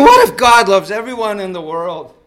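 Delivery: loud, high outburst; impassioned preaching. Real, not acted. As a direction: cry out the question to the congregation. Preacher drives home his question.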